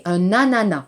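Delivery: ANANAS ending on an open "ah", no s sound; silent final s. Shown as a demonstration. Teacher showing students the French word, final s silent in ANANAS.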